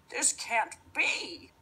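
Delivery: witch voice. Acted. Witch baffled.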